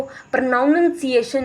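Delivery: प्रोनाउंसिएशन as pra-NOUN-un-si-ation, syllable added; mispronounced word. Shown as a demonstration. Warning teachers what not to say, mispronounced. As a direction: botch प्रोनाउंसिएशन with a doubled "nun". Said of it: "ना बोलें".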